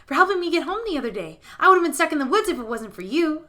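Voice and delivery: put-on a child earnestly saying thanks; grateful scarecrow boy. Scarecrow boy thanks the witch for her help, earnest and high.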